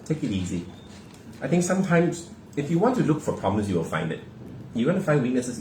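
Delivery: ordinary delivery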